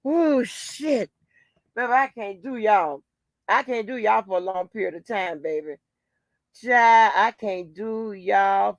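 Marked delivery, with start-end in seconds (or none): none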